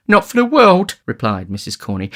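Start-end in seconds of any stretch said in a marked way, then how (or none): 0.1-1.0 high, loud refusal; distressed lady. A narrator's reading. Matron turns down the beadle's offer, distressed. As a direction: refuse in a high, fluttery lady's voice.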